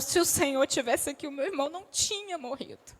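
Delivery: grieving Mary, voice sinking away at the end; Mary weeping at Jesus' feet, lightly acted; mild weeping Mary. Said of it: "do Senhor chorando".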